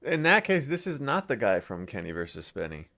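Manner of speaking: ordinary talk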